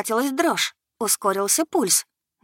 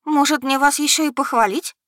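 taken-aback sarcasm; 7 semitones higher, 5 dB louder than usual. Acted. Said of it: "поинтересовалась, опешивши".

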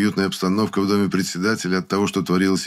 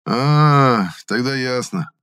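drawn-out, higher-pitched realisation with a smile; knowing smile; 6 semitones higher, 4 dB louder, much slower than usual. A narrator's reading. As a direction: stretch the "А" as it dawns on you. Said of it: "растянул губы в улыбке Гудков".

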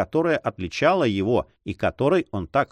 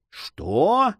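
a sharp, much higher furious "Что?"; furious outcry; 10 semitones higher than usual. A narrator's reading. Young man bursts out at the chevalier's boast, furious. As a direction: burst out in rage at the rival. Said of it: "с бешенством вскричал молодой человек".

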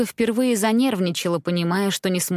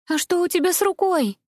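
small fairy voice; 10 semitones higher than usual. A narrator's reading. Small fairy asks worriedly.

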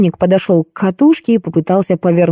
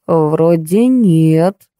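very slow, hesitant reply; squeezed out reluctantly; much slower than usual. Acted. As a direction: drag the words out unwillingly. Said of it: "наконец выдавил он из себя".

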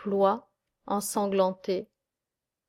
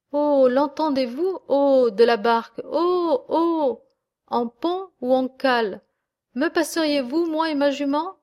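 higher and louder, hailing the boat; calling out from afar; 5 semitones higher, 4 dB louder than usual. A narrator's reading. The fleeing herdsman hails the boatmen, shouting from afar. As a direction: call across the river to the boatmen. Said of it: "le lâche crie à ses trois bateliers".

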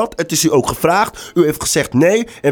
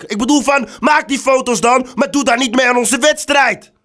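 angry yelling; 5 semitones higher than usual. Acted. Agency man yelling at the model over nude photos, furious and high. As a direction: berate her at the top of your voice. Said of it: "dat je zo schreeuwt".

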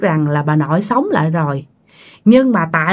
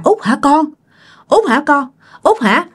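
lightly acted, higher-pitched questioning; a touch of disbelief, pitched up; anxious mother asking; 7 semitones higher than usual. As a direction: call gently to check it is your child. Mother asks if it is her daughter.

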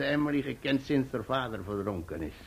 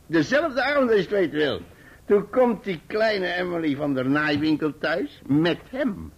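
building excitement; 7 semitones higher, 6 dB louder than usual. Acted. Building to the big reveal.

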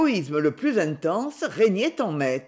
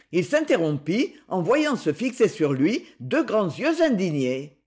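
plain reading voice

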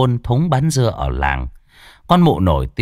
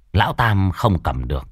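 ordinary delivery, nothing marked

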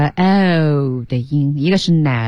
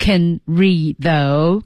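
very slow, each word of "can read though" drawn out; slow drill; much slower than usual. Shown as a demonstration. Teacher drilling the phrase slowly for learners. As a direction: spell it out slowly.

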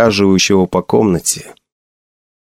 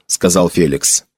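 plain reading voice

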